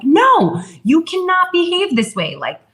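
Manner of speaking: high-pitched parental scolding, retold. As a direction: act out laying down the law to a bossy child. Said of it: "raising my voice and being like".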